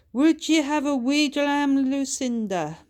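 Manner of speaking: loud, high Scottish request; cheeky Scottish ask; Scottish accent. A narrator's reading. Bertha asks her sister for a dram in a loud Scottish accent.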